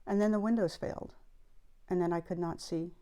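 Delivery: soft, measured poem recital; poetry reading. Acted. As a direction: read the poem quietly, pausing at each break. Reads the poem aloud.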